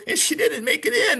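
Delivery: high, strained quote of the husband; a lightly voiced grieving husband; mock husband. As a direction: imitate him faking grief. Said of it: "He goes".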